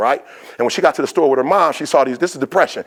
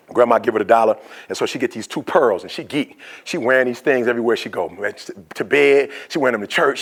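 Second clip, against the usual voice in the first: ordinary delivery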